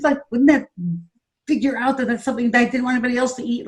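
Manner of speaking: high, wounded tirade, rushing at the end; insane rant. Retold or imitated. Scolding the nanny over eaten lamb chops, high indignant rant. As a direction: rant like the hurt is unbearable. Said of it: "it was insane what I was saying".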